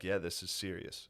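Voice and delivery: low, slow, quiet solemn agreement; mock seriousness. Comic recalling playing along, mock serious. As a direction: go along gravely, very softly. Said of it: "I had to be like".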